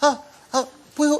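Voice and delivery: put-on high, hesitant little-child voice; adorable kids. Children begging the director on video, imitated high halting plea. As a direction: plead like a shy small child. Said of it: "his kids sent the most adorable video".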